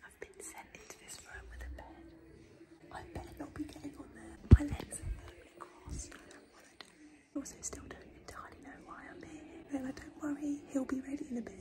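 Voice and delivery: hushed, barely voiced whisper; nervous whisper. Real, not acted. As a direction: whisper secretly from the exam room while waiting.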